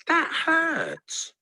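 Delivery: very high hurt character voice; pained yelp. A narrator's reading. Strawberry protests to Tomato after the crash, yelping. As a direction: squeal the complaint up high. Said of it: "yelped Strawberry".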